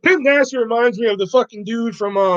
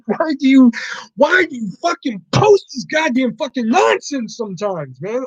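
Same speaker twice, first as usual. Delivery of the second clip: half-laughing exasperated yell; exasperated rant; 6 semitones higher than usual. Real, not acted. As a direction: vent at the chat in disbelief. Venting at chat post.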